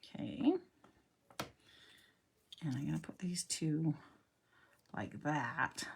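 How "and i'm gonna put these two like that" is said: whisper. Real, not acted. Quiet crafting aside.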